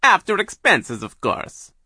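high, loud villainess; Doctor Orwell. A narrator's reading. Hypnotist agrees with her accomplice, high loud voice. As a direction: agree loudly, dropping at the end. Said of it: "Doctor Orwell said".

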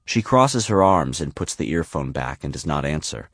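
usual speaking voice

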